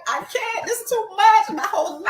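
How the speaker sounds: half-laughing panic; overwhelmed. Retold or imitated. Her overwhelmed reply quoted.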